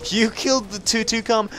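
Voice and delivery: ordinary talk, nothing marked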